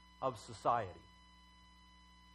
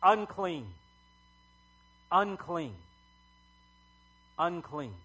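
half-raised warning cry, dying away; repeated leper's call, fading from loud to usual voice; acted yelling; 4 dB louder, slower than usual. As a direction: call it out, each time less forcefully. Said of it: "you're yelling".

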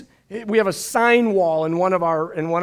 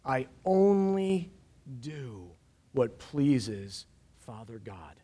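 quiet verse quote; 6 semitones lower, 8 dB quieter, much slower than usual. Shown as a demonstration. Pastor quoting verse as believer.

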